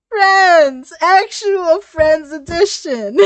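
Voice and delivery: loud, high, slow delighted exclaim; excited shout. Real, not acted. Reader cheering the friendship, loud and high.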